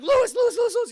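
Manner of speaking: high, loud, repeated panicked calling; frantic mock cries. Anna calls to her bleeding husband, mock panic. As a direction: call the name frantically, hammed-up.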